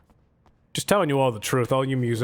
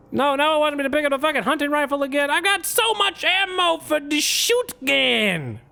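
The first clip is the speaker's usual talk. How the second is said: much higher, whining complaint; whiny high voice; 12 semitones higher than usual. Real, not acted. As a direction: whine it up high.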